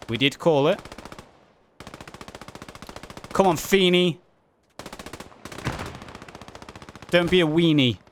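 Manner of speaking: energetic match commentary; live play-by-play. Real, not acted. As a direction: call the action as it happens.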